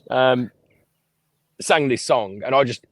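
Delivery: ordinary talk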